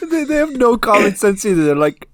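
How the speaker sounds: high, loud laughing speech. Real, not acted. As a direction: talk through hard laughter. Laughing hard while talking.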